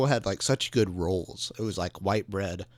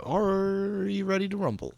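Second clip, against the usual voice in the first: mock ring announcer; 10 semitones higher than usual. Joining the fake radio intro, hammed-up ring announcer. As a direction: ham up a fight announcer's big cry.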